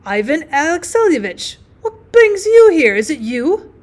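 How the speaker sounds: loud, high cry of glad surprise; a slow, overjoyed greeting; joyful shout. A narrator's reading. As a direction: call out his name in delight. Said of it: "utters a shout of joy".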